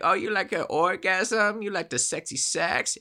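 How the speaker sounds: a man poorly pretending to be a lady; terrible lady voice. The scammer still faking a woman on the phone, badly. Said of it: "him doing a terrible lady accent".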